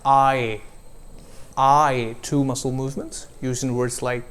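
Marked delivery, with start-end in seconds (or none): 0.1-2.2 I gliding from ah to ee; diphthong demo. Shown as a demonstration. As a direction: stretch the I into two sounds. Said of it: "Another example of a diphthong".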